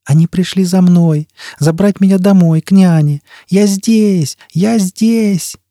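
put-on high, eager child's call; small voice rising to a high, drawn-out cry. A narrator's reading. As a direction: call to rescuers as a little child. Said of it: "Телепатический вопль".

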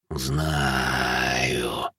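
one long, low, soft exhaled word; rustling bodiless voice. A narrator's reading. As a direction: stretch it into a fading ghostly rustle. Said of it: "прошелестел бесплотный голос". (бесплотный шелестящий голос гуля, низко и протяжно)

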